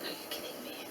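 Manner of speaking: whispered are you kidding me; disbelieving whisper. Retold or imitated. Mother to doctors, stunned whisper.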